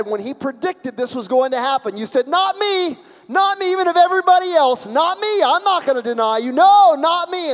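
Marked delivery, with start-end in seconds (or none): 2.3-7.5 loud, high, emphatic refusal; acted boast, shouted high; bold vow. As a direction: proclaim it at full force. Acting Peter's bold promise.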